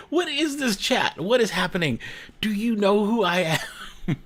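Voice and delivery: high-pitched, hammed-up indignation; mock outrage.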